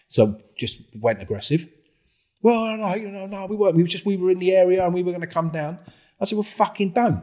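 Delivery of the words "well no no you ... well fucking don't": high flustered excuse. Retold or imitated. Friend backtracking, him snapping.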